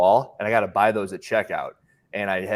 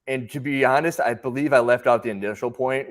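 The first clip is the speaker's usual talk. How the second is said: normal talking voice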